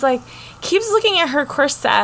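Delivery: ordinary talk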